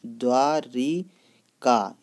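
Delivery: द्वारिका stretched out very slowly, syllable by syllable; slow, deliberate द्वारिका; drawn-out spelling demo. Shown as a demonstration. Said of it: "इस प्रकार लिख देते हैं द्वारिका".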